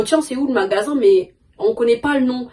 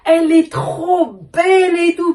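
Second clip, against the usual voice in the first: retold wow; 7 semitones higher, much slower than usual. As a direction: gasp it out like you just spotted the perfect pair. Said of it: "je fais".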